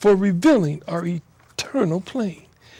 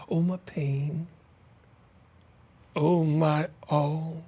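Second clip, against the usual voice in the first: breathy whisper of the line; 5 semitones lower, 6 dB quieter than usual. Acted. Poet whispering the refrain to listeners, hushed. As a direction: drop to a bare whisper.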